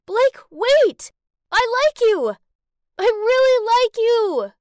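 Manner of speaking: high, very loud desperate cry after someone; despairing shout. Acted. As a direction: shout it out desperately, stretching the last line. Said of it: "shouted in despair".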